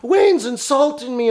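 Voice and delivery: high whiny mock complaint; mock upset student. Mocking upset viewers who complain about him. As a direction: mimic a touchy trader crying foul. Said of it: "people get all upset".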